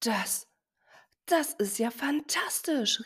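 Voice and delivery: high, soft, slow astonished exclamation; amazed. Acted. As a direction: marvel at it, moved. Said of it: "rief seine Mama".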